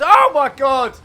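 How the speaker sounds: loud, high-pitched exclamation; real astonishment. Real, not acted. Commentator spotting a racket toss, astonished outburst. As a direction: burst out in amazement.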